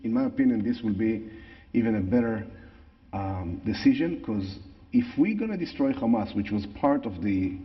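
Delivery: English with a natural Israeli accent. Real, not acted. Same speaker continues.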